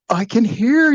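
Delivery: high, exclaimed retold protest; indignant protest. Protesting that he hears the radio.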